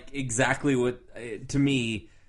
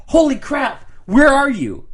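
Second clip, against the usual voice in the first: higher and louder, mock astonished call; excited exclamation; 11 semitones higher, 10 dB louder than usual. Retelling a friend's surprised text, excited and loud. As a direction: act out the friend's eager surprise.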